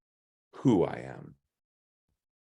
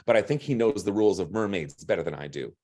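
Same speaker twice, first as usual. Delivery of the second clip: usual speaking voice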